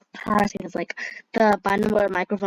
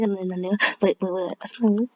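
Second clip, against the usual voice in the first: ordinary talk, nothing marked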